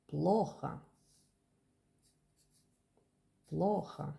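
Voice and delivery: Плохо with stress on ПЛО and the final O said as A; stress on first O. Shown as a demonstration. Teacher drilling the stress in Плохо. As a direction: hit the first syllable of Плохо. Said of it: "the second O became A because the accent is on the first".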